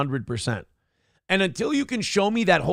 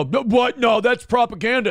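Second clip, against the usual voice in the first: mocking denier; 7 semitones higher, 4 dB louder than usual. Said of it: "they'll go".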